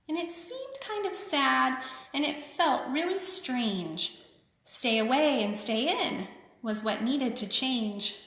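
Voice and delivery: sing-song picture book reading; lilting read-aloud for kids; story reading. A narrator's reading. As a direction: read it like a rhyming children's book. Reads rhyming picture book aloud.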